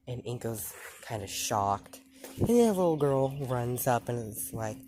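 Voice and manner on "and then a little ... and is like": normal talking voice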